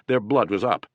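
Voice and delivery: ordinary delivery, nothing marked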